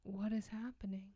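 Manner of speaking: hushed whisper. Real, not acted. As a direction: whisper it in the dark. Whispered in the dark.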